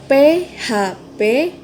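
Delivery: "P H P" slowly, each letter apart; deliberate letter-by-letter reading. Shown as a demonstration. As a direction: spell it out slowly. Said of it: "It has three syllables".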